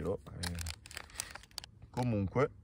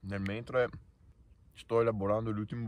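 chewing while talking. Real, not acted. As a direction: mouth partly full. Seen 1: Hand at pursed mouth, appears chewing bar bite early in clip.

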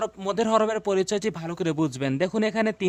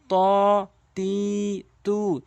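drawn-out vowels; much slower than usual. Shown as a demonstration. Teacher demonstrating long vowels to viewers, slow and stretched. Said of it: "মদের হরফ হিসাবে টেনে টেনে পড়তে হবে".

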